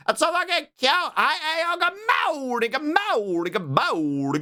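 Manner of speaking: high-pitched excited squeal; gushing over cuteness. Real, not acted. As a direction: squeal it way up high, overcome by cute. Gushing over cute fan art.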